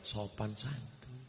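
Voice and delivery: gently, as if to prove he can be soft; answering the complaint that he is harsh, very soft; softened on purpose. Shown as a demonstration. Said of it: "kalau gitu kita perlembut".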